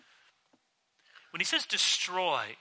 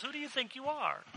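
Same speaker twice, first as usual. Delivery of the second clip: much higher but softer, sneering; scornful challenge; 9 semitones higher, 8 dB quieter than usual. Retold or imitated. Leaders sneering at Jesus, scornful. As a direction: toss the question down with contempt.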